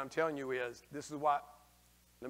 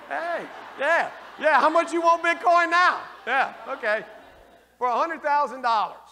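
high, loud laughing banter; preacher hamming up a bribe offer; joking haggle; 11 semitones higher, 14 dB louder than usual. Acted. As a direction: tease the crowd while laughing. Mock bargaining with congregation about Bitcoin.

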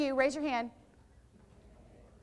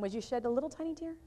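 ordinary talk

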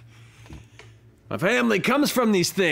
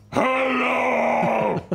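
booming, higher shout; magically amplified voice; 6 semitones higher than usual. Pretend. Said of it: "make my voice extremely loud".

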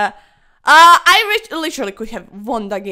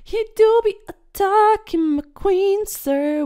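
mocking drawl; 8 semitones higher, slower than usual. Mocking the opponent word.

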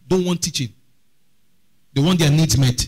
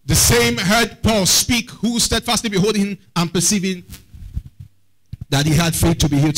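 scripture reading. Real, not acted. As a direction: lay the verse out word by word. Preacher reading scripture aloud.

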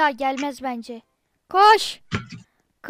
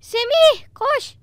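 calling out; 10 semitones higher, 5 dB louder, much slower than usual. Real, not acted. Oyunda arkadaşına koş diye bağırıyor.

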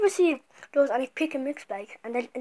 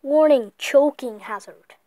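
loud warning read; 12 dB louder, slower than usual. Shown as a demonstration. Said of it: "if you just read the packet".

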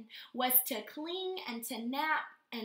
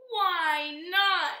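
loud, higher cheering call; 6 semitones higher, 9 dB louder than usual. Acted. Friends below cheering up to Kevin, loud and bright. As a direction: cheer him on from the ground. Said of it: "cried the Ruse with a super loud cheer".

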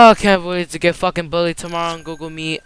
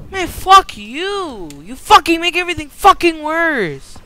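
raging; 9 semitones higher than usual. Real, not acted. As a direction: spit it at the game in real fury. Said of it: "Am I raging".

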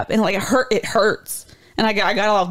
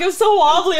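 normal talking voice

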